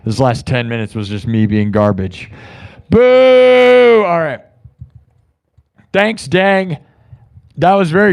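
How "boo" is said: sudden loud high boo; playful loud jeer at himself; mock boo. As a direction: boo yourself like a heckler. Booing himself after bad segment.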